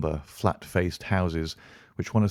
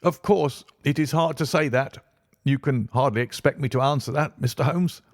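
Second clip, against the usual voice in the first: nervous; 7 semitones higher than usual. Acted. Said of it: "in a nervous fashion".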